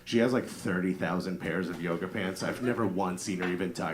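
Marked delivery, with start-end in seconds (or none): none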